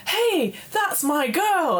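high, drawn-out jealous claim; slow mock brothers' shout. Three brothers claiming the girl, high slow protest. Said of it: "all three brothers go".

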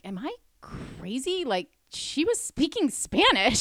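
high, drawn-out disbelief; retold bewilderment. Bartender retelling her appeal to a coworker, incredulous. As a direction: plead your case in slow, incredulous peaks.

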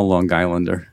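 Long Islander run together as one word; Long Island accent, hard g joining Long to Islander. Shown as a demonstration. Guest shows off his home New York accent, Long Island pronunciation. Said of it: "that Long Island becomes one word".

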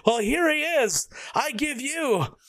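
slow, drawn-out ring introduction; grand announcer intro. Retold or imitated. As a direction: build it up like a ringside reveal. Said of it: "And he's like".

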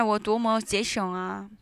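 ordinary delivery

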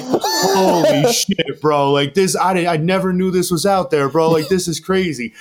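high, laughing mock exclamation; imitating a guest who is laughing in disbelief. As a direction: crack up while blurting it out. Max cracking up at video.

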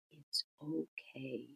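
soft, hushed reassurance, half whispered in places; very gentle. Retold or imitated. Therapist reassuring a stuttering third grader, very gently and softly. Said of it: "I just very gently said".

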